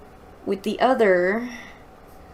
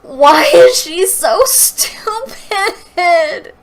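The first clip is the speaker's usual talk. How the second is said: much louder, higher, slow, through laughter; laughing disbelief; 10 semitones higher, 12 dB louder, much slower than usual. Real, not acted. Reader mocking Maggie's outrage, laughing loudly. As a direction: drag it out in amused disbelief.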